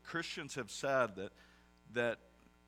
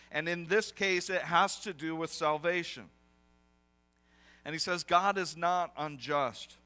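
preaching at full force; 4 semitones higher, 7 dB louder than usual. Acted. Preacher rallying a discouraged congregation, loud and building. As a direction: preach it out loud to lift them.

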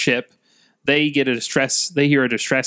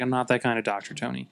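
quiet deadpan; 7 dB quieter than usual. Retold or imitated. Bruce quote, fading.

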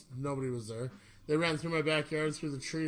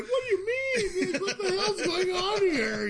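very high, loud, laughing fake innocence; mock innocent teen; 5 dB louder than usual. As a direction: play the clueless kid caught out. Feigning innocence to the cops.

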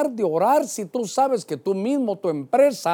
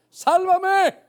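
high, drawn-out cry for rescue; 13 semitones higher, 4 dB louder than usual. Acted. Preacher showing how a drowning man prays, crying out. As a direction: call out high, as if sinking. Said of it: "Clamar es gritar".

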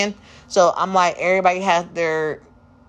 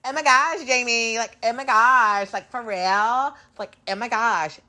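put-on high, slow valley girl gush; 5 semitones higher than usual. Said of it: "talk like a valley girl".

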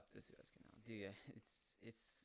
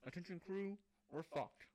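raised-pitch mock intercom address; captain's announcement; 6 semitones higher than usual. Captain telling crew they are stuck, mock announcement.

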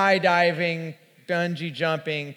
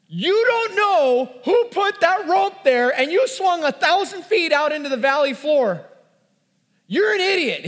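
exasperated yell; 11 semitones higher, 5 dB louder than usual. Retold or imitated. Telling off his friend on the cliff, high, loud and outraged. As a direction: drag out the words in high-pitched outrage. Yelling at friend about the rope.

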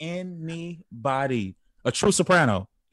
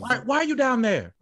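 higher, louder mock heckle; teasing shout; 11 semitones higher, 5 dB louder than usual. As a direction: call up at a singer.

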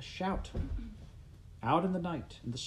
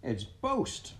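raised pitch, exclaiming in recognition; glad cry; 6 semitones higher than usual. A narrator's reading. Pa recognises his friend, crying out. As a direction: cry out the visitor's name. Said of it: "Pa cried".

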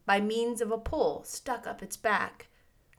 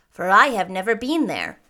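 louder, solemn warning; grave; 8 dB louder than usual. A narrator's reading. Munchkin warning the girl about the dangerous road, grave. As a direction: warn with grave weight. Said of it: "answered Boq gravely".

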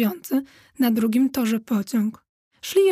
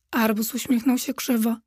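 ordinary delivery

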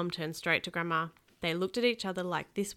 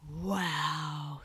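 awed; 3 semitones lower than usual. A narrator's reading. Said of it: "said Kenny".

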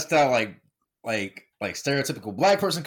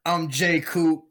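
slow, loud, higher announcer delivery; podcast intro announcement; 5 semitones higher, 5 dB louder, slower than usual. Acted. Host welcoming listeners back to the show, announcer style. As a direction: announce the show with spaced-out, punched phrases.

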